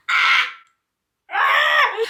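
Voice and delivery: high-pitched cringe squeal; cringing scream. Real, not acted. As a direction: let out a high, embarrassed shriek. Cringing at her old video.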